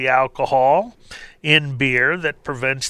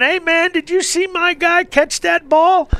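much higher, excited yelling at the TV; screaming fan; 12 semitones higher than usual. Retold or imitated. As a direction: cheer for your guy like a crazed fan. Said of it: "they're all screaming at the T V going".

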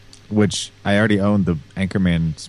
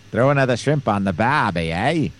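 higher, broad mock Australian accent; 4 semitones higher than usual. Shown as a demonstration. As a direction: ham up an Australian accent, pitched up. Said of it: "I do my Australian thing".

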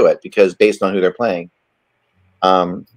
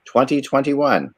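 higher, punchy announcer read; show intro; 5 semitones higher than usual. Acted. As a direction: open the show like a host. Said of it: "That sounded so convincing".

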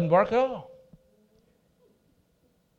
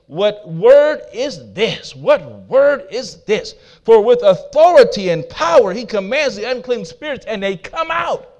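slow, loud, higher amazed question; amazed crowd; 5 semitones higher, 8 dB louder, slower than usual. Acted. Preacher voicing the amazed crowd, slow loud wonder. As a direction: marvel at it, slowly and loud.

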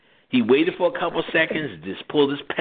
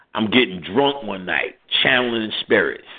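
ordinary delivery

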